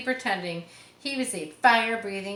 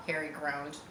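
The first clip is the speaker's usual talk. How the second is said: ordinary delivery, nothing marked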